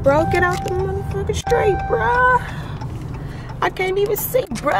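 high, yelling at a driver; road rage. Real, not acted. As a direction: snap at the car in the way. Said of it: "I get severe road rage".

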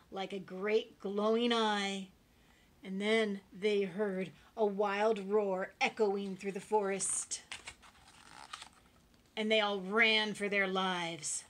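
loud, booming storytelling; dramatic climax narration. A narrator's reading. Narrator telling children of a wild roar, loud and dramatic. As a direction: build the suspense at full volume.

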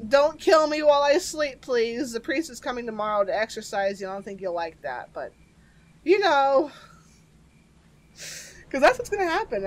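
normal talking voice

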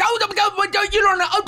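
high, frantic denial, almost shouting; panicked protest. Retold or imitated. Joker trying to calm the furious daughter, panicked high protest. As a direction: backpedal in a panic. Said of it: "I'm like".

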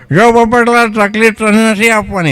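high, lightly voiced client; client's voice. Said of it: "એમ કે". (ઊંચો અવાજ, હળવો ગ્રાહક)

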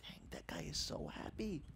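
hushed envious whisper; whispered aside of envy. Retold or imitated. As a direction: breathe it to yourself in awe. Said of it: "I was like".

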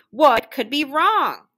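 emphatic storybook bewilderment; puzzled wondering. A narrator's reading. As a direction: draw out the question, mystified. Puzzled character wonders what is wrong.

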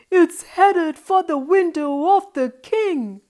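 loud, high storybook alarm; alarmed cry. A narrator's reading. Boy watches ball head for the king's window, alarmed cry. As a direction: call out in dramatic dismay. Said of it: "he cried".

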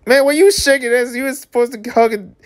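very high, squeaky protest; exasperated heckling. Acted. Reader heckling married couple for shaking hands, exasperated. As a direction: whine in a high voice, mock outraged.

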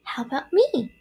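high little questioning voice; little spider. A narrator's reading. Third spider wants a name too, small voice. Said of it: "asked the third spider".

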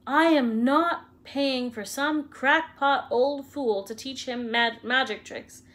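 furious uncle shouting, drawn out; yelled. A narrator's reading. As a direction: bellow it out at the crackpot. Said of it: "yelled Uncle Vernon".

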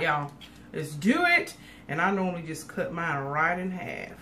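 normal talking voice

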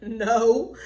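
loud, drawn-out groaning no; exasperated no. Acted. Disciples answer the man on shore, loudly exasperated. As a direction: blurt it out, fed up.

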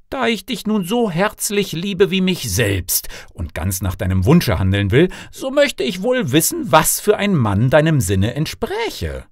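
generous father, questions swinging up high; fond king father. Acted. King offers daughter any husband.

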